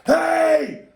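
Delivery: high, loud startled shout; panicked yell. Retold or imitated. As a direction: yell out as if suddenly threatened. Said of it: "Jack gets panicked and goes".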